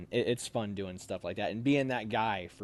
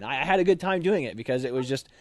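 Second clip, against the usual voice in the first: ordinary talk, nothing marked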